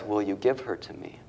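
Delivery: ordinary talk, nothing marked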